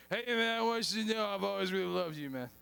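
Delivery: put-on lightly slurred, gushing drunk guy; a hint of sloppy drunken affection; drunk councilman. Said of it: "second drunkest city in America".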